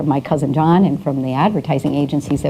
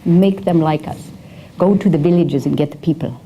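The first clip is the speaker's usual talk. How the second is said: put-on Persian accent. Mall owners ordering their PR woman, in a thick Persian accent. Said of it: "in their very thick accent".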